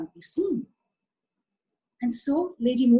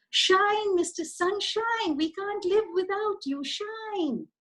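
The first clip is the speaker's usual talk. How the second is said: much higher, slow pleading plant voices; sing-song begging little plants; 10 semitones higher, slower than usual. Acted. Plants begging Mister Sunshine to shine, much higher.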